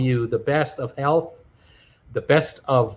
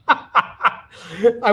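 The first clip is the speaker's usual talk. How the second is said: laughing; 5 semitones higher than usual. Real, not acted.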